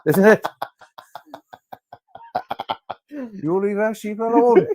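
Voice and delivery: bad Welsh voice, laughing through it; poor Welsh accent. Host mocking the Welsh in a poor Welsh accent. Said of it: "poor Welsh accent".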